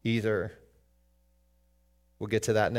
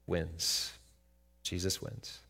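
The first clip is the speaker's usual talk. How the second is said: soft, hushed answer; hushed declaration; quiet answer; 6 semitones lower, 9 dB quieter, slower than usual. Acted. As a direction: drop to a hush for the answer. Preacher answers own question softly.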